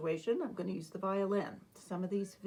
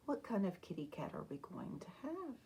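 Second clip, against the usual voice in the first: quick, hushed whisper; 7 dB quieter, faster than usual. Acted. Teacher whispering to children, inviting their choice. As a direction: whisper the question to draw them in. Teacher asks pupils to choose.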